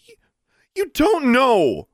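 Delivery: high, loud, drawn-out outburst; angry shout. A narrator's reading. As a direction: shout it slowly in desperate protest. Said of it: "Alexandra shouted".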